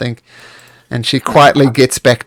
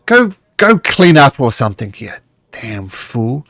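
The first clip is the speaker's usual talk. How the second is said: a hint of a gruff master's voice; mild dismissive growl at the servant; deeper voice; 3 semitones higher, 4 dB louder, slower than usual. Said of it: "a much deeper voice".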